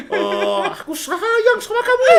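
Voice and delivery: high, laughing mock-tender coo; mock sweet talk. Spouse mid-fight mocking sweet words to partner, laughing. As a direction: laugh through a fake lovey-dovey line. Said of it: "Kalau konflik itu".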